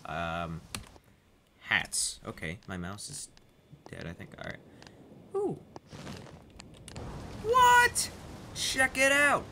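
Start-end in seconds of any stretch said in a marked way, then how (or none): 5.4-9.4 very high, loud excitement; amazed shriek. Real, not acted. Player showing off a new hat, amazed squeal. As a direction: squeal it in delighted shock.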